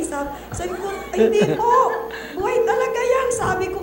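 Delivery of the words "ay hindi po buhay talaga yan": high, loud insistence in an argument; mother arguing with the doctor, loud and high; arguing protest. Retold or imitated. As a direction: fight back, pitch up. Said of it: "talagang nag-away kami ng doktor".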